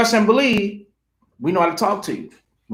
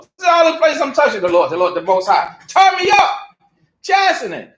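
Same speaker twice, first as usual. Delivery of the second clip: fervent preaching; 5 semitones higher, faster than usual. Real, not acted. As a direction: preach it out with fire. Preaching at full force.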